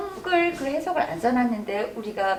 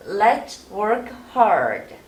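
slow model read; 2 semitones lower, much slower than usual. Shown as a demonstration. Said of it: "구부리다가 뒤에 드 하면 돼요".

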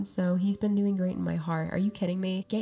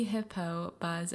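ordinary talk

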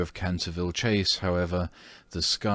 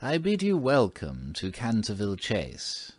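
lightly voiced old housekeeper, quaint and formal; an old servant's courtly welcome, a little higher; quaint old-fashioned; 4 semitones higher than usual. A narrator's reading. Old housekeeper greeting the new family, in a quaint old-fashioned manner. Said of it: "in a quaint, old-fashioned manner".

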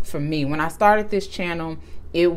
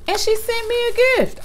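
high-pitched, amazed squeak; overwhelmed disbelief. Real, not acted. Vlogger marvels at a sick friend's gift, voice high with amazement. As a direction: let the voice leap way up in disbelief.

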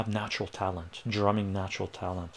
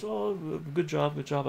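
higher-pitched mock teacher praise; voiced piano teacher's praise; 6 semitones higher than usual. Piano teacher praising the student after a lesson, higher-pitched approval.

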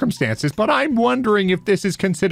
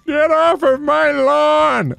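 put-on slow, high cranky old man yelling; grumpy old man; 9 semitones higher, 4 dB louder, slower than usual. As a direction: growl it like a cranky old neighbor. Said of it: "old man you'd normally see".

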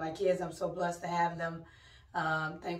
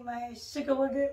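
cutesy pet name; 6 semitones higher than usual. Real, not acted. Mom greets daughter by pet name.

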